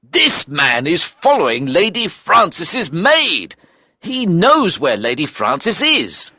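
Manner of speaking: high, slow, emphatic accusation; heated accusation. Acted. Narrator accuses the man.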